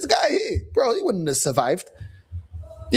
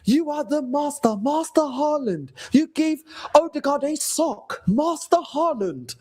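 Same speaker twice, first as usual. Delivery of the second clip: higher, slow servile house-elf voice; Dobby impression; 7 semitones higher, slower than usual. Odegaard as Dobby thanking his master Haaland, slow servile elf voice. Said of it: "descendant of Dobby from Harry Potter".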